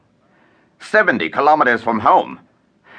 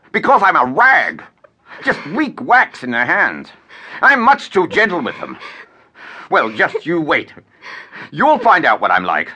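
furious rant; 6 semitones higher than usual. Acted.

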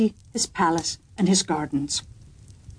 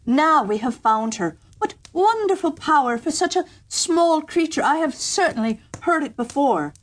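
higher, mildly gushing courtier; eager young courtier; 7 semitones higher than usual. A narrator's reading. Young courtier mistaking the cow for the bird, mock delight. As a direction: gush over a cow's lowing.

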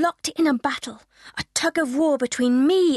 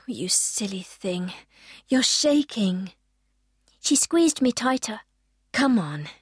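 comforting mum; 6 semitones lower than usual. Acted. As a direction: soothe a shaking child gently. Mother comforting her frightened daughter.

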